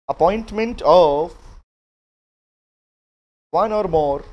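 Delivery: slow, spaced-out classroom dictation; teacher dictating notes word by word. Acted. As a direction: read each phrase out slowly so they can write it down.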